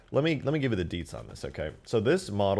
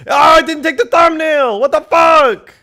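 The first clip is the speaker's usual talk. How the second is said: high, very loud outraged yell; angry shout; 16 dB louder, slower than usual. Real, not acted. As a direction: scream in exasperation at a failed upload. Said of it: "I'm mad".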